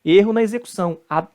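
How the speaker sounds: ordinary talk, nothing marked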